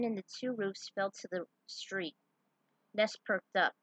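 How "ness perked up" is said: plain reading voice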